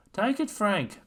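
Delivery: loud, high call across the pitch; bellowing. Acted. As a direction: shout it to a teammate. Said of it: "Pat bellowed".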